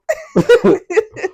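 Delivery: high burst of laughter; big delighted laugh; loud laugh. Real, not acted. Laughs after retelling.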